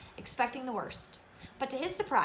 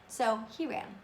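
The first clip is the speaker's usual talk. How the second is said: usual speaking voice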